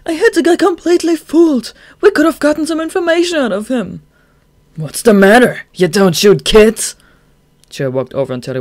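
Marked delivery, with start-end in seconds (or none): 0.1-4.0 high, loud exasperated whine; whiny complaint. Acted. Dazai complaining at Chuya. 4.8-6.9 loud, slow mocking dare; mocking taunt. Chuya taunting the gunman.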